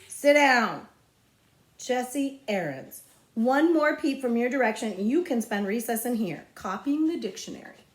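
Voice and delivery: a hint of a loud stern teacher. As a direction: scold the boy firmly. Stern teacher scolding the class.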